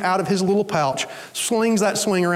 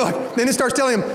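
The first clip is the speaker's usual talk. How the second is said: normal talking voice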